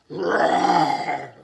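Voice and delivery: echo roar. Acted. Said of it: "the roar he heard".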